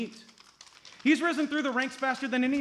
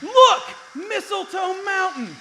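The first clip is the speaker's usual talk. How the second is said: much higher, louder cry of discovery; high, thrilled, slower; excited exclaim; 8 semitones higher, 8 dB louder, slower than usual. Acted. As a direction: point and shout. Spotting the mountain.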